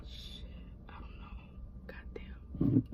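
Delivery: breathy whispered swearing under her breath; whispered curse. Real, not acted. Fussing over bright car lighting, whispering curses to herself. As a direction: hush it, cursing to yourself.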